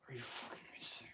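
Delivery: harsh whispered aside; shocked stage whisper; horrified whisper. Pretend. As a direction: hiss it under your breath in disbelief.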